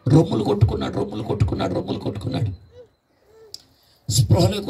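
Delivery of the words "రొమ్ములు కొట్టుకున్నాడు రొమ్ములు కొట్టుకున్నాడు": fading whisper. A narrator's reading. Preacher telling how the man beat his chest, fading to a whisper. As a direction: repeat it softer each time, down to a whisper.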